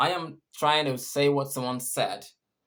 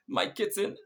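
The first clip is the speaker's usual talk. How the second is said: higher, halting demo of crying; mock sobbing; 6 semitones higher than usual. Shown as a demonstration. Teacher shows how crying breaks up speech, mock sobbing. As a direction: let the words come out broken, as if crying. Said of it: "words might not come out straight".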